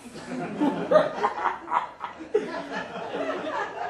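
high moustache-twirling villain cackle; breathy evil villain laugh. Retold or imitated. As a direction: cackle like a pantomime baddie. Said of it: "moustache-twirling laugh".

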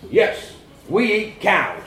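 loud, slow fatherly confirmation; exasperated dad. A narrator's reading. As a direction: hammer it home slowly.

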